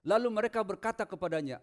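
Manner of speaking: normal talking voice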